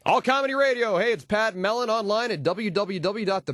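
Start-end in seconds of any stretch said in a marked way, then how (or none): none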